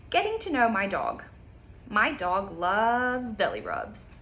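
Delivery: bright storybook reading, slow on the details; reading to children. Acted. Teacher reading a picture book to kids, lively storytime voice. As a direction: read it aloud like storytime.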